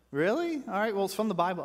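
high, incredulous mock reply; a hint of a stunned stranger; mock surprise.

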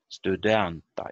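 "Studenta" stressed on DEN; stress on second syllable. Shown as a demonstration. Teacher repeats the loan word, "Studenta" stressed on DEN. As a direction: put the weight on the middle of "Studenta". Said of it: "stress is not in the first".

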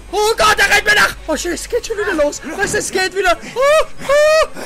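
high panicked laughing cries; being chased. Real, not acted. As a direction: flee screaming and half laughing. Running from pursuer, laughing.

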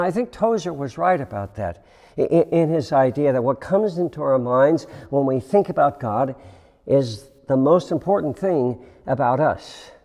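usual speaking voice

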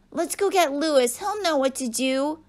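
put-on high, eager child character voice; excited rallying. A narrator's reading. Pupil to classmates fetching help, excited rallying. Maritza rallies the restored children.

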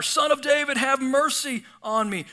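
high, loud cry for mercy; high, loud plea called out to Jesus; shouting. Retold or imitated. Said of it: "he only shouted louder".

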